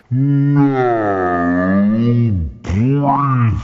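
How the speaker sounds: long, drawn-out stern command; loud strict-teacher order, stretched out. Acted. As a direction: order the class with menace. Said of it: "stand out the front going".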